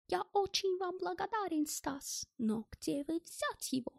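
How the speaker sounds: high, softened foreigner's voice; grateful German character. A narrator's reading. German thanks Stas for his passport, grateful high voice. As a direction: thank him earnestly in a high voice, then enquire.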